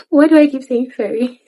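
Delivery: high, loud, amused through laughter; laughing. Real, not acted. Speaker amused at own repeated word, laughing and high. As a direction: laugh while asking yourself.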